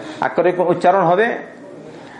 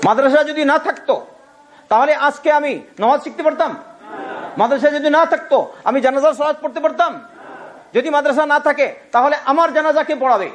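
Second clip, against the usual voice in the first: impassioned preaching; 7 semitones higher than usual. Real, not acted.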